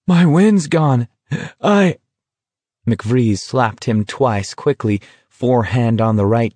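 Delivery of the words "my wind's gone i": slow, high, breath-starved words; gasping. A narrator's reading. Garrity tells McVrees he has no wind left, gasping. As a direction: wheeze it out and give up mid-sentence. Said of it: "Garrity gasped".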